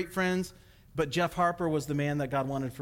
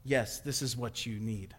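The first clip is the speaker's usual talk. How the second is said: God's calm answer; 5 semitones lower, 5 dB quieter than usual. Retold or imitated. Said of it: "And God says".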